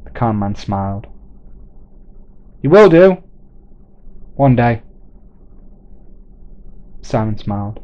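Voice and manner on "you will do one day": loud high call, then settling; calling back. Acted. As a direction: shout the promise, ease off at the end. Man calling back to Simon.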